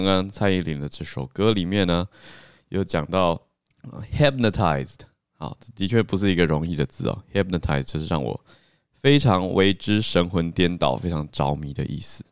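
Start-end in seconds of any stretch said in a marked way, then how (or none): none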